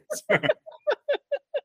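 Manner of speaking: shrill burst of laughter; high squealing laugh. Real, not acted. Laughing at co-host's joke.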